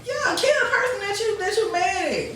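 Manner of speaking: high-pitched, exasperated protest; exasperated plea. Acted. Speaker tells imagined attacker to leave bystanders out, exasperated. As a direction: argue it like you are fed up.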